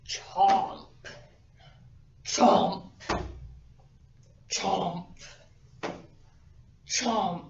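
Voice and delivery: loud heavy chomps for a huge alligator; snapping teeth. Acted. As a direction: chomp big, with the whole body. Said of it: "this enormous alligator goes".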